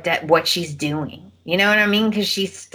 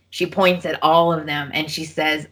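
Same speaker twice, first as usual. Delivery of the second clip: usual speaking voice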